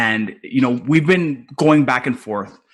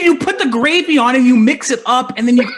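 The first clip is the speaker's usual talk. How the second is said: heated defense; 10 semitones higher, 3 dB louder than usual. Real, not acted. Defending rice and peas.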